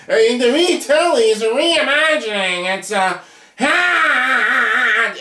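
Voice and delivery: high, whiny mock protest stretching out; mocking defenders. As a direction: parody fans protesting, high-pitched.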